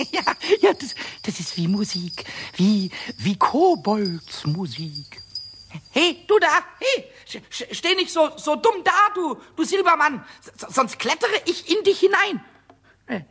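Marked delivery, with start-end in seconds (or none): 5.9-12.4 louder, higher, bossy little shout; cheeky shouting. Acted. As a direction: yell at the statue to provoke it. Kobold scolding a silver statue.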